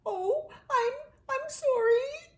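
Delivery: high, shaky-voiced apology; frightened little mole; shuddering. A narrator's reading. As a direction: stammer it out, scared. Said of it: "shuddered the mole".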